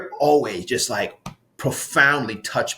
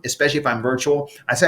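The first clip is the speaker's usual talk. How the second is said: ordinary talk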